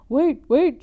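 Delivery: high, loud cry to stop them; shouting. Acted. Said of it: "shouted Antonia".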